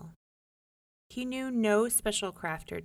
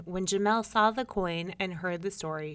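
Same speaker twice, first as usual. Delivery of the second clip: plain reading voice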